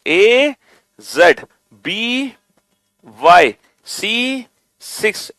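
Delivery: slow drawn-out chant, led for the class; slow loud reading along. Shown as a demonstration. Teacher leads the class reading aloud, slow and drawn out. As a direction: stretch each letter for the kids to follow. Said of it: "मेरे साथ पढ़ो जोर से".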